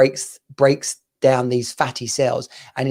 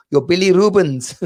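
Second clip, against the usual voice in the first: higher-pitched mock Indian-y accent; 4 semitones higher, 4 dB louder than usual. Caller repeats the baffling term, imitated Indian-y accent. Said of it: "got a sort of Indian-y accent".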